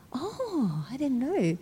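soft, slightly lifted realization; mild dawning surprise. Retold or imitated. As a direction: let a small, quiet "oh" of discovery out. Acts out own reaction to husband.

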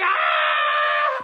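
high mock scream of panic; retold panicked scream. Retelling the messy accident to friends, panicked scream. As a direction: shriek as if panicking, for the story. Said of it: "I start screaming".

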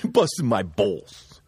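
slow, lightly voiced New York Italian brush-off; Italian tough guy. Friend retelling Sonny telling Pat to quit it. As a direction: wave it off like a mobster. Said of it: "He goes".

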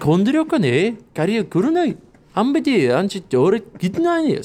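high, fake Korean-sounding babble; mock Korean gibberish. Comic performing two girls chatting in mock Korean. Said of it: "It's like".

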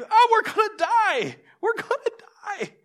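acted high-pitched panicked cry; terrified wail. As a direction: wail it like a fisherman in a storm. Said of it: "They're terrified".

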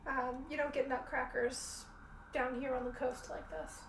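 ordinary delivery

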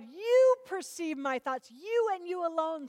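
high, stretched stress on each "you"; slow, insistent "you and you alone"; emphatic YOU. Acted. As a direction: punch "You" high and hold it. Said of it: "It's emphatic".

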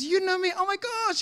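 high-pitched excited disbelief; expressive amazement. Retold or imitated. Old acquaintance amazed to meet the preacher, excited. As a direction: imitate someone thrilled by a reunion. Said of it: "he's so expressive".

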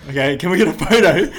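high, loud, excited asking; eager request. Retold or imitated. Fan to [name], asking loudly and eagerly. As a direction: blurt the request out to your idol. Fan asks idol for a photo.

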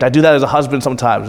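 ordinary talk

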